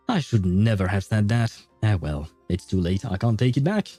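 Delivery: ordinary delivery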